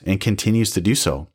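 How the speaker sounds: usual speaking voice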